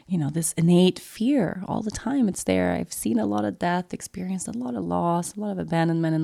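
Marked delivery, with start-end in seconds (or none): none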